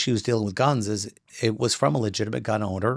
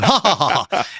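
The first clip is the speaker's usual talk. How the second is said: mock "ha ha ha" at himself; mocking laugh; 4 semitones higher than usual. Laughing at his own reflection, a mock ha-ha. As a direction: jeer at yourself with a fake laugh.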